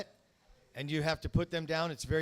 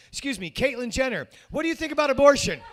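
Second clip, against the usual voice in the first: higher, louder, nearly yelling; mock shouted question; 9 semitones higher, 8 dB louder than usual. Comic retelling confronting Caitlyn Jenner, mock shout. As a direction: blurt the question up high. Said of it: "I walked right up to her and I said".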